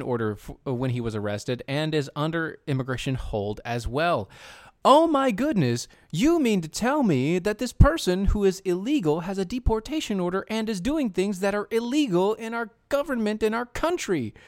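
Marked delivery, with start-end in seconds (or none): 4.8-14.3 mock shock. Mock outrage at illegal immigrant.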